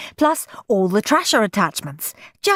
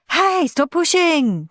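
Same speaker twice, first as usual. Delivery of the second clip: higher, slow shout; 11 semitones higher, 3 dB louder, slower than usual. A narrator's reading. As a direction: yell at Henry pushing in. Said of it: "shouted Dizzy Dave".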